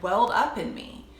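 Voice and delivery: ordinary delivery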